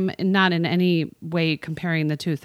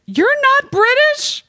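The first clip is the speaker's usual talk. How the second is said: high, slow, disbelieving You're not British?; surprised question; 6 dB louder, slower than usual. Retold or imitated. Fan realizing the host isn't British, surprised. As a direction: ask it in shock, rising at British. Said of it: "he was like".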